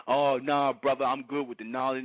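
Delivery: lightly voiced smug brother; mocking a know-it-all. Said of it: "It's like".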